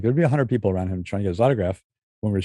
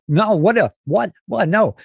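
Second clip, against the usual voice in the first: imitating Walken; 9 semitones higher, slower than usual. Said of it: "He goes".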